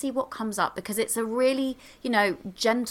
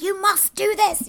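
higher, slow mock strict order; stern command; 12 semitones higher, 5 dB louder than usual. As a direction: play a bossy taskmaster, lightly. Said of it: "It's not in that, like".

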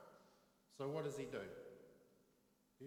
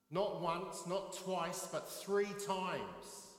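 Not once, not twice, but three times, each count louder and higher, three times drawn out slowly; counting stress; 5 semitones higher, 5 dB louder, much slower than usual. Retold or imitated. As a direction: build the count to a slow accusing peak. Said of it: "this little voice in my head goes".

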